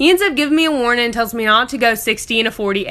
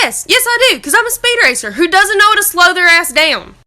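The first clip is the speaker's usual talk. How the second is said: loud-ish, raised-pitch mock eagerness; sarcastic confession; 4 semitones higher, 4 dB louder than usual. Driver to cop at the window, sarcastic confession. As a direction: answer the cop with exaggerated, higher-pitched sass.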